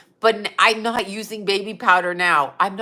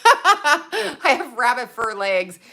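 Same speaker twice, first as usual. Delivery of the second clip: laughing; 5 semitones higher, slower than usual. Real, not acted. Laughing about her hairy legs.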